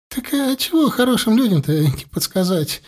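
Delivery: высоко, шмыгая носом; мужичок угодливо соглашается, шмыгая. A narrator's reading. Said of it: "шмыгнул тот носом". (шмыгая носом)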